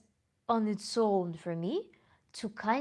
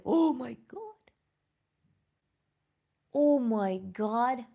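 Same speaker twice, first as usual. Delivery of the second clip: sudden shriek at a spider; real fright, drawn-out and loud; startled shriek; 5 semitones higher, 5 dB louder, slower than usual. Real, not acted. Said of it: "It startled me".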